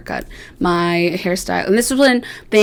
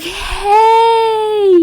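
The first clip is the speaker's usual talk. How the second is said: high, loud cried-out hey; eagerly pitching a shaved head to the stylist; excited hey; 14 semitones higher, 5 dB louder than usual. Retold or imitated. Messaging stylist about shaving.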